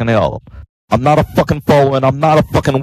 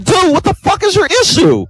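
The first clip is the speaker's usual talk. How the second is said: exasperated shout. Real, not acted. Exasperated outburst at a troller.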